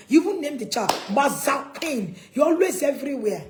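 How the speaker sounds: acted exasperated outburst; scolding parent. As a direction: call out a child's faults loudly. Acts parent scolding an outgoing child.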